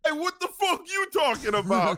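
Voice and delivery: put-on very high angry New York accent; indignant hammy New Yorker outburst; mock New Yorker. New Yorker defending his pizza, very high New York accent.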